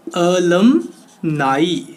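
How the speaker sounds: अलुमनाई very slowly, split "a-lum... naa-ee"; teacher drawing out अलुमनाई, ending like "eye"; syllable-by-syllable pronunciation. Shown as a demonstration. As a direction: stretch अलुमनाई in parts, rising on "nai". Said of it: "क्या है जी?".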